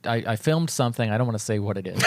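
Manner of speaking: usual speaking voice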